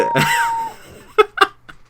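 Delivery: shrieking laugh; real delighted cackle; high burst of laughter. Real, not acted. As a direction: break into a high squealing laugh. Host laughs at guest's embarrassment.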